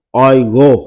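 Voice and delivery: "oigo." nói to, lên giọng cuối; đọc mẫu "oigo." rõ và to; model word. Shown as a demonstration. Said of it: "Chữ O là bạn đọc". (đọc mẫu "oigo." to, lên giọng)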